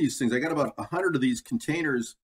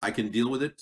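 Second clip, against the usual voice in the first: tin can sound; 5 semitones lower than usual. Real, not acted. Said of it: "Sounds like a tin can".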